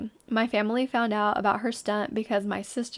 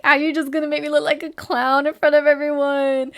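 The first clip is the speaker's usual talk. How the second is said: mock upset dad; 5 semitones higher, 7 dB louder than usual. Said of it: "I'd be like".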